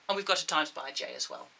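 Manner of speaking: usual speaking voice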